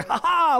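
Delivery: loud, high mocking "ha ha!"; gloating "ha ha!". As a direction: crow a triumphant laugh-shout. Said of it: "I did it like that in the game".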